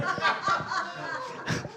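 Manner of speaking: high, soft burst of laughter. Real, not acted. Preacher recalling the bus-stop dealer, soft high laugh. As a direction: crack up at the absurd offer.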